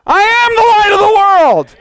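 high, loud full-force proclamation; convinced shout. Shown as a demonstration. Preacher showing unconvinced saints how, impassioned shout. Shows the convinced version after mock doubt.

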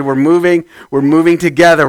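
ordinary delivery

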